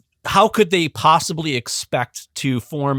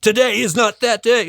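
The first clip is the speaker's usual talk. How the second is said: rousing movie commander; 8 semitones higher, 3 dB louder than usual. Acted. Mock movie battle speech continues.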